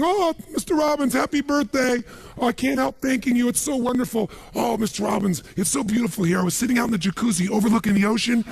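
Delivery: high, gushing maid's voice. Retold or imitated. Maid gushing to her boss on his birthday, high and sweet. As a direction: coo it like a thrilled housekeeper. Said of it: "she's back at home in the castle going".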